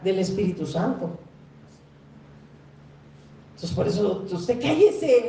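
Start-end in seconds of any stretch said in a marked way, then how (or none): 4.3-5.2 higher, louder rebuke; stern order. Acted. Jesus ordering the demons to hush, higher and louder. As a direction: snap it like a command to be silent.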